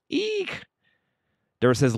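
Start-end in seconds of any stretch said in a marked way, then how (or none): 0.1-0.6 very high little eek; mock squeal. Host reacting to Lamb's trouble getting on, mock squeal.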